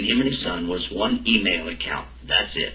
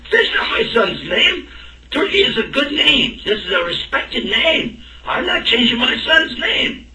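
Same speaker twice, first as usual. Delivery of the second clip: indignant; 13 semitones higher than usual. Retold or imitated. Father insists on the name.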